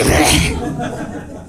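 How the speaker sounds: hammed-up monster growl; werewolf snarl. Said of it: "that's what happened to her".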